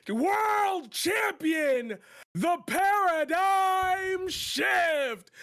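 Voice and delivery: building to a drawn-out, high shout; ring announcer. Pretend. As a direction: stretch each title like a boxing announcer. Mock ring-announcer self introduction.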